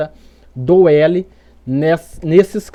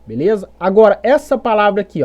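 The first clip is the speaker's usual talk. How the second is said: ordinary talk, nothing marked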